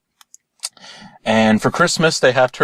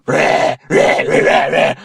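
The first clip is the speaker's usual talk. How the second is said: raspy, voiceless monster growls; troll roars; 4 semitones higher, slower than usual. Acted. Troll attacking in the bathroom, roaring. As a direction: roar "rah" like a rampaging troll. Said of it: "the trolls are going".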